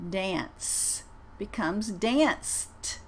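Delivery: "danced" drawn out very slowly with a clear final T; crisp T at the end of "danced"; teacher models "danced" for students, very slow. Shown as a demonstration. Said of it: "I'm gonna say them twice".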